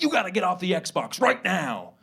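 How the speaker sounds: a hint of an older brother giving orders; big brother. Big brother ordering little brother off the Xbox, mock-bossy. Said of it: "he'll come home and he'll be like".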